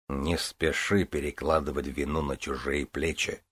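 low, soft stern rebuke; stern priest. Acted. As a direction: rebuke her gravely. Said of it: "сурово объявил святой отец".

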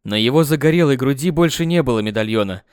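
usual speaking voice

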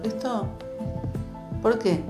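ordinary delivery

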